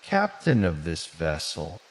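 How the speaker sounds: plain reading voice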